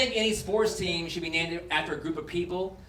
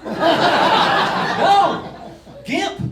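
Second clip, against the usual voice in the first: loud correction; 9 semitones higher, 8 dB louder than usual. Real, not acted. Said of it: "correcting himself loudly".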